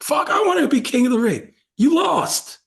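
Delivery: angry wrestler. Retold or imitated.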